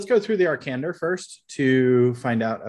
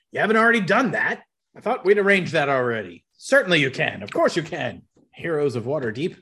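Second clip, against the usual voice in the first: higher-pitched, teasing mock surprise; facetious; 5 semitones higher than usual. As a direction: play Durnan's answer a bit tongue-in-cheek. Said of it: "He says a bit facetiously".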